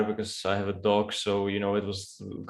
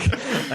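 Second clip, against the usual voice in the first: short, high burst of laughter; 13 semitones higher than usual. Real, not acted.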